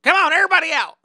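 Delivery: high, very loud call; shouted order. Retold or imitated. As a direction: clear everyone out with a yell. Driver empties the bus.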